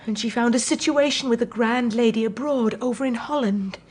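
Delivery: ordinary talk, nothing marked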